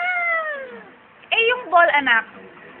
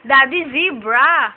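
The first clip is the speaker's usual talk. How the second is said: slow, louder call of Daddy, zebra, each word drawn out; slow prompt; 7 dB louder, slower than usual. Shown as a demonstration. Mom coaching her child to call Daddy, slow and louder. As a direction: spell it out slowly for a toddler to repeat. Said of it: "Sabi mo kay Daddy".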